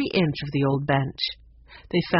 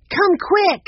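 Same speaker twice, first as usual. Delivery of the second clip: loud, very high urgent call; high excited shout; urgent cry; 13 semitones higher, 7 dB louder than usual. Acted. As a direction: call someone over in alarm. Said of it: "she cried".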